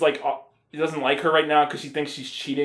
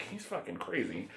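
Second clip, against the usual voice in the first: much softer, hushed aside; mock whisper aside; 5 semitones lower, 17 dB quieter than usual. Zooey warns about the botanist, hushed. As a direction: tell it quietly like a secret. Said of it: "Zooey Deschanel's like".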